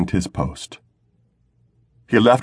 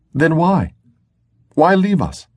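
much higher, plaintive question; pleading Sarah; 10 semitones higher than usual. A narrator's reading. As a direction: beg him, pitch lifted high. Sarah presses him.

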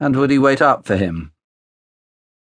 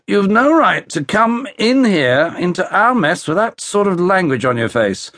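put-on raised, indignant young officer; indignant protest; 8 semitones higher than usual. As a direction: push back hard at him.

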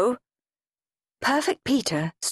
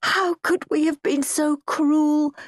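mock shriek; 8 semitones higher than usual. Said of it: "Mum would shriek".

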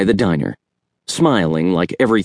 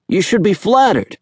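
higher, teasing uncle's voice; Uncle Jerry, higher voiced; 11 semitones higher than usual. A narrator's reading. Uncle teasing his nephew, higher playful voice. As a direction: tease the boy kindly, pitched up. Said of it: "he said".

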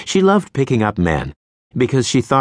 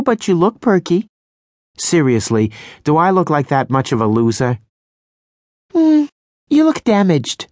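much higher, playful; bubbly friend voice; 9 semitones higher than usual. A narrator's reading. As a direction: tease like a cheeky friend. Stacy bubbly voice.

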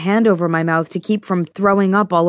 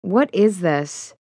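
disgusted; slower than usual. A narrator's reading. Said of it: "lips peeled up over my teeth".

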